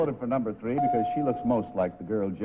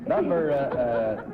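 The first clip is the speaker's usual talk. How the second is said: laughing; 5 semitones higher than usual. Real, not acted. Panelist laughing through question.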